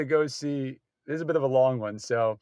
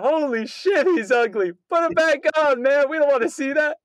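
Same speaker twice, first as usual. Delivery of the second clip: high, raised heckler's holler; outraged audience member, pitched way up; yelled heckle; 11 semitones higher, 4 dB louder than usual. Retold or imitated. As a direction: yell it out across the theater. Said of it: "she just yelled out".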